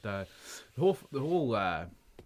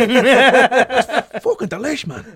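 laughing mock relish; 19 dB louder, much slower than usual. Acting a film character.

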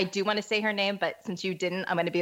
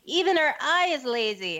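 put-on high, louder sneering kid-brother taunt; higher, louder schoolyard taunt; mocking older brother; 7 semitones higher, 4 dB louder than usual. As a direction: jeer it like a bratty big brother. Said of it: "older brother who used to, like, ridicule me".

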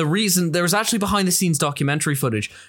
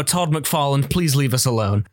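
normal talking voice

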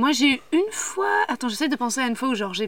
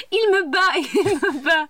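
high, drawn-out mock cry for help; imitated screaming woman; 7 semitones higher, slower than usual. Listener mimics his impression of the woman, high and drawn out. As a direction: laughingly parody the wailing victim. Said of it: "ton imitation de la femme".

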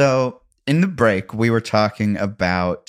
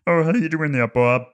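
higher, put-on cartoon handyman voice; Teddy; 7 semitones higher than usual. Shown as a demonstration. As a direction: imitate Ray Romano's way of talking. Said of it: "I can kind of do an impression of".

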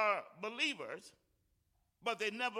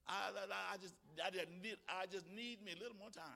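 sleepy excuse; 3 semitones lower, 12 dB quieter than usual. Retold or imitated. Mocking a sleepy snoozer's excuse.

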